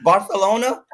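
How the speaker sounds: Barcelona with a TH for the c, "Bar-the-lona"; Spanish-style lisped c. Shown as a demonstration. Podcaster showing off Spanish place names, lisped TH in Barcelona.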